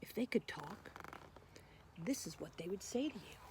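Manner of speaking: soft secret-sharing whisper. Acted. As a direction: hush the kids before the turtles talk. Reader sets up the turtles' speech.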